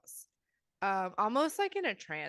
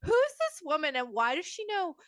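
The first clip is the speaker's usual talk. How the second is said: mock panic; 6 semitones higher, faster than usual. Co-host voicing Bobby, scared of the stranger: mock panic. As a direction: play frightened Bobby a bit hammed-up.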